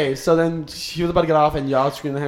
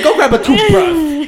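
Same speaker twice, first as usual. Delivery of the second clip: laughing mock quote; 7 semitones higher, 4 dB louder, much slower than usual. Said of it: "She said".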